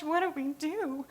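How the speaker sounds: a therapist's mock panic as the child sobs; panicked.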